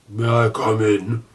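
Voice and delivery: slow, lightly voiced beast asking politely; a gentle monster asking to enter, slowly; Beast's voice. A narrator's reading. Beast asks politely at dinner.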